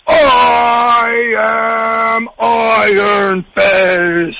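slow, booming robot shout; big robot voice. Retold or imitated. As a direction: drag it out like a giant robot singer. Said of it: "he sounds like a big robot".

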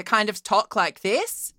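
rising lift at the end of "this"; Australian intonation. Shown as a demonstration. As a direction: let "this" swing upward like a question. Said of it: "even if I'm not doing the accent".

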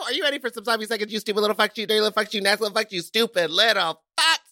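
brash hype-man intro; fast teasing host hype; mock-rude announcer. Hosts' mock-rude show opener.

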